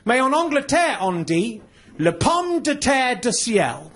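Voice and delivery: slow, deliberate teacher's French. Acted. French teacher addresses little boys learning English, slow classroom French. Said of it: "The teacher comes in one day".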